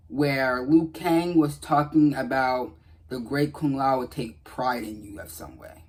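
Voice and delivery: normal talking voice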